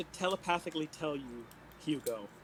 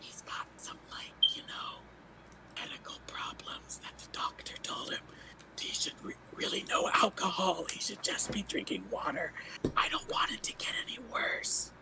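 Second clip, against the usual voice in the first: unvoiced whisper into his ear; 6 dB quieter than usual. Whispering a secret to Iron Lung in his ear. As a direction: breathe it secretly, no voice at all. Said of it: "I'm gonna whisper in his ear".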